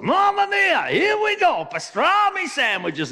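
shrieking mock-Italian exasperation, high and loud; over-the-top Italian despair; Italian character. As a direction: explode as a hammed-up Italian worker. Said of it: "Italian opened his lunchbox".

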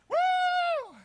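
high, loud "woo!"; excited whoop. Acted. Eager believer cheering for Jesus as king, excited whoop. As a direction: whoop like a party crowd.

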